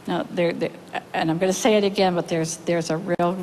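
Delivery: usual speaking voice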